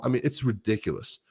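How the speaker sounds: normal talking voice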